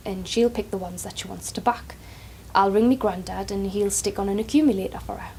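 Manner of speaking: normal talking voice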